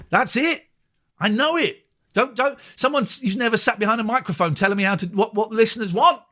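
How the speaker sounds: high-pitched, worked-up protest; exasperated, rising well above normal pitch; retold indignant rant. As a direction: act out bristling at bosses who think they know better. Re-enacted argument with station bosses.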